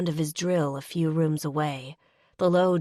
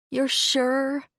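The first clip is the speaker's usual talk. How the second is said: tearful; 9 semitones higher than usual. Acted. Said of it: "I ask tearfully".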